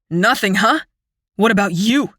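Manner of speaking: a touch of bitter scorn; frightened brother turning on his sister; spitting words. Acted. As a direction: throw the question back at her. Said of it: "He practically spits".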